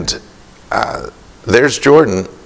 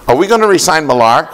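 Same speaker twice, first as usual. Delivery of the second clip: higher and quick, with a laugh in it; eager little boy; 8 semitones higher, 3 dB louder, faster than usual. Retold or imitated. Boy on the phone with his hero, asking in a quick, high voice. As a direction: rush the question like an excited kid.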